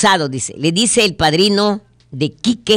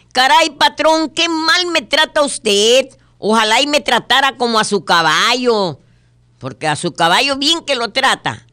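whiny worker; 7 semitones higher than usual. Acted. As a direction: plead with the boss in a high whine. Worker complains to his boss.

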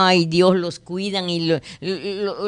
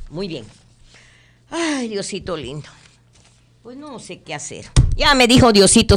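normal talking voice

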